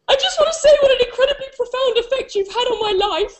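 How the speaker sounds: high, crying through a laugh; tearful gushing. Retold or imitated. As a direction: choke it out on the verge of tears. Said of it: "I burst into tears".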